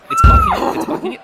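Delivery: high, loud panicked squeal; panicked shriek. Acted. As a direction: shriek as the horse bursts in. Horse breaking down the door.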